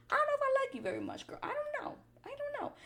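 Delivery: very high, squeaky mock reaction; put-on high little voice; high-pitched doubt. Acted out inner reaction.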